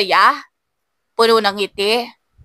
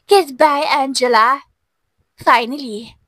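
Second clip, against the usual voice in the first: smug gloating; 4 semitones higher than usual. A narrator's reading. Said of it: "Ngumisi siya".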